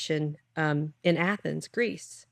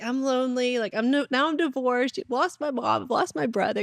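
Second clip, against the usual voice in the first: whining; 8 semitones higher than usual. Retold or imitated. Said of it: "I was whining".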